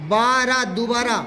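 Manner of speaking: normal talking voice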